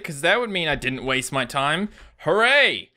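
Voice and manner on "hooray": loud mock hooray; sarcastic cheer. Real, not acted. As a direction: cheer with no joy at all. Sarcastic cheer after dying.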